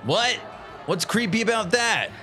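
ordinary delivery

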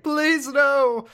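high, loud scream of panic; terrified plea. Retold or imitated. Terrified man begging the disciple, screaming high and loud. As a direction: cry out in terror. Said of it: "And he's like".

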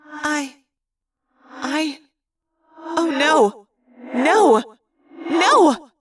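faltering, then rising cries of dismay; echoing, halting protest that climbs to a cry; thought-speak voice. A narrator's reading. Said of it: "it was Rachel's thought speak voice".

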